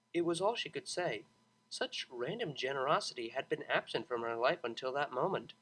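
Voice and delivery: ordinary delivery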